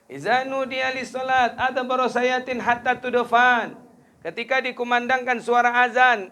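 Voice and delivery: high, loud impassioned sermon climax; fiery preaching. Acted. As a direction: preach it full force, rising to a peak. Preaching at full force.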